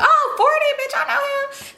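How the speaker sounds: very high-pitched, put-on gushing; mock excited squeal. How she'd act if she knew him, mock excited squeal. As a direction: squeal it as a hammed-up show of recognition.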